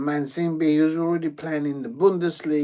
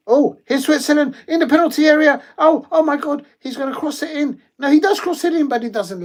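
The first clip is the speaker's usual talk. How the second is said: much higher and louder, real excitement as Switzerland attack the box; excited commentary; 12 semitones higher, 10 dB louder than usual. Real, not acted. Commentator watching a Swiss attack in the box, excited high shouting. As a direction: yell it out as the cross comes in. Chance in the penalty area.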